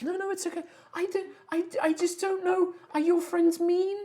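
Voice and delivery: high, hesitant and nervous; a timid high voice asking about the friends; wood spirit. Acted.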